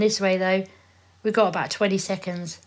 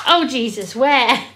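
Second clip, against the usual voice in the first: startled; 4 semitones higher, 6 dB louder, slower than usual. Acted. Acted startle retake.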